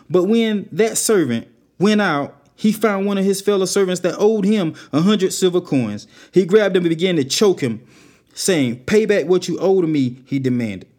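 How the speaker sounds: preacher raising the story to a peak; preaching. Acted. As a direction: drive the parable home to the congregation. Loud dramatic retelling of parable.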